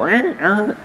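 high honking air horn noise; air horn imitation. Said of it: "where you just go".

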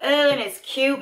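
normal talking voice

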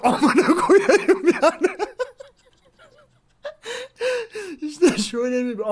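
cursing through loud laughter; laughing. Real, not acted. Bursts laughing after retelling mom. (kahkaha atarak küfür)

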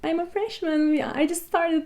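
mock British accent she tried as a first-year student; fake British accent. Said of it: "İngiliz aksanı yapmaya çalışıyorum".

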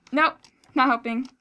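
ordinary delivery

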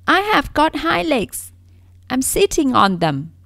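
loud, high, hurt insistence; upset protest. Acted. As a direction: defend yourself, stung and loud.

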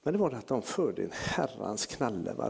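normal talking voice

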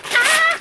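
very high, loud squeal; short excited shriek of delight; high-pitched happy scream. Real, not acted. Squeals at cocoa snowman.

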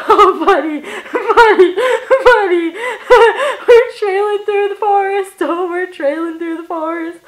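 high, loud words breaking into laughter; laughing hard. Real, not acted. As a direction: call out through real giggles. Laughing over a remembered song.

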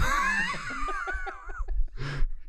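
real quiet, high wheezing laugh; long laugh trailing into squeaks. Real, not acted. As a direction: crack up helplessly. Cracks up at the accented No.

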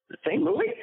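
retelling his stunned reply to the studio; incredulous. Retold or imitated. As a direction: pitch it way up in disbelief. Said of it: "And I'm like".

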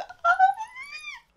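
very high, loud squeal of delight; high-pitched squeal. Real, not acted. Fan overwhelmed by the couple, squealing loudly. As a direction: squeal as high as you can, thrilled.

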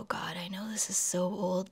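guilty little whisper. Real, not acted. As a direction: hush it like a secret. Whispered admission.

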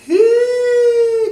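loud held high hee; high sustained hee. Shown as a demonstration. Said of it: "do that slide for me".

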